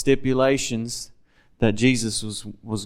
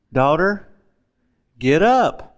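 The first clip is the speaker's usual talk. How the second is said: commanding; 3 semitones higher, slower than usual. Retold or imitated. Jesus raises the girl.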